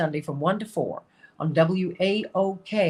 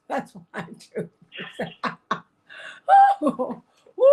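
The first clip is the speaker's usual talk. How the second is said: laughing; 9 semitones higher than usual. Real, not acted. Laughing at own CIA reaction.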